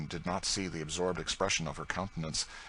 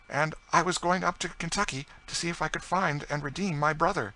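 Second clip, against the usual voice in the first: high-pitched, impassioned lady's account; higher, emotional lady's storytelling; earnest French lady; 10 semitones higher, 4 dB louder than usual. A narrator's reading. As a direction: tell your history earnestly in a raised voice.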